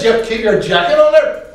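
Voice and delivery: lightly put-on Donegal Irish lilt; northern Irish accent. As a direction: do an insistent northern Irishman asking. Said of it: "Eamon Malloy was from the north of Ireland".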